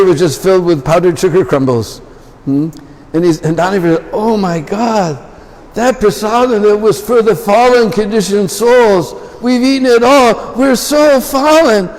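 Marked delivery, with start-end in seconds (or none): 4.1-10.5 much higher, slow, mock-horrified wail; hammed-up panic over the empty pot; mock dismay. Danavir horrified at eaten prasadam. 10.6-11.9 much higher, slow hammed-up wail; mock lament. Danavir laments.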